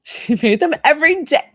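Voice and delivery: high, loud, laughing gush; gushing delight. Real, not acted. Medium gushing with joy over the grandmother's habit. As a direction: burst out with delight.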